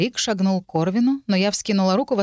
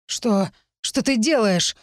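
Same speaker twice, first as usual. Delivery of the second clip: plain reading voice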